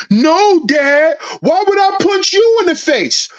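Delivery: mock frightened son backing down; hammed-up scared boy. Son's scared reply.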